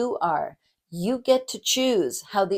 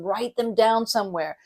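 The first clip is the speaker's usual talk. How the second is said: normal talking voice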